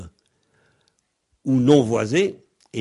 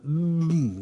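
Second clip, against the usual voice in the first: soft closed-lip hum; hummed mm; 4 semitones higher, 3 dB quieter than usual. Shown as a demonstration. Teacher letting listeners hear the buzz, quietly. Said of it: "une espèce de mm".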